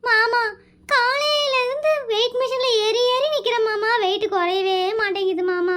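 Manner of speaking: sing-song comic sobbing; hammed-up crying. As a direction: bawl the grievance in a long mock wail. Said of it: "எதுக்கு அழுதுட்டு இருக்கிற?".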